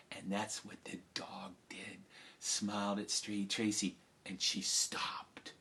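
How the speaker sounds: hushed narration. A narrator's reading. Storyteller hushing the crowd at the key moment, whispered. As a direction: draw them in, barely above a breath.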